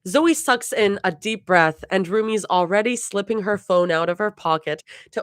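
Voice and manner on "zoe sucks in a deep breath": usual speaking voice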